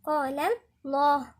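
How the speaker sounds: الله said with a full dark L; emphatic, backed "llah" in الله; heavy lam. Shown as a demonstration. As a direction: split قال, then a thick الله. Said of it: "فخمنا اللام بتاعت لفظ جلالة الله".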